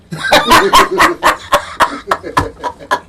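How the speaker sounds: long high, wheezing laugh; real laughter. Real, not acted. Host teased about taking it personally, high wheezy laughter. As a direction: laugh hard and high, out of breath.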